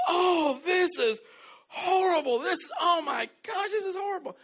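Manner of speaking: very high, loud, hammed-up cry of disgust; disgusted wail. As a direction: wail like the drink betrayed you. Friend tastes unstirred soda.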